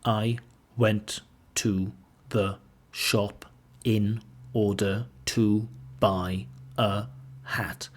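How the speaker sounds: slow, word by word with gaps; no chunking, every word isolated and evenly spaced; mechanical, robotic. Shown as a demonstration. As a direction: pronounce each word separately, like a robot. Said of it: "the language sounds mechanical, robotic".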